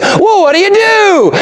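put-on high, bright old southern man calling out. Old man greeting Fred at his table, high old-timer voice.

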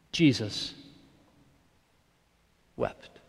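Jesus wept, dropped low and soft; a quiet, grave reading; hushed and slow. Shown as a demonstration. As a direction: let Jesus wept fall very softly. Scripture line, then reflection.

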